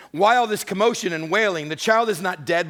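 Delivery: commanding challenge to mourners. Acted. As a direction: call over the wailing crowd. Jesus confronting mourners.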